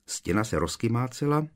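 ordinary delivery, nothing marked

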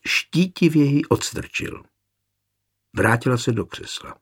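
ordinary delivery, nothing marked

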